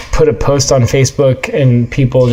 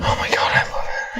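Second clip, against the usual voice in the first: excited hushed whisper; 10 dB quieter, faster than usual. Real, not acted. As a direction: whisper it with delight. Hushed reaction before the poem.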